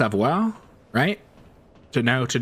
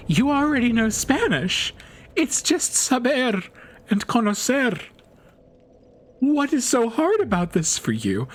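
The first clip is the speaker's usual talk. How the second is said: high-pitched mock exasperation; exasperated retort; 11 semitones higher than usual. Retells exasperated retort to classmate.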